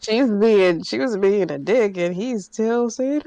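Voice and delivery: ordinary talk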